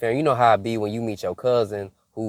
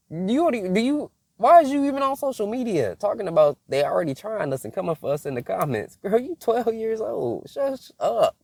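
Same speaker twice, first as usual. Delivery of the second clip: put-on raised voice telling a kid to hush; sassy scolding; 10 semitones higher than usual. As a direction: scold her like a fed-up auntie. Scolding twelve-year-old girl.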